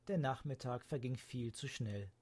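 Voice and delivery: usual speaking voice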